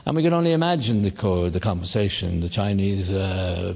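ordinary talk

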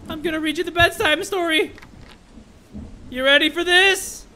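high, excited lead-in; playful announcement. Pretend. Streamer announces a bedtime story, playful high voice.

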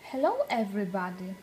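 ordinary delivery

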